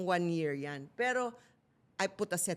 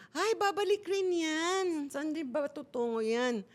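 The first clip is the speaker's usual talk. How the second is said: mocking religious friends; 10 semitones higher than usual. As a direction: ham up the pious friends brushing it off. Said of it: "sabi ng mga kaibigan kong religious".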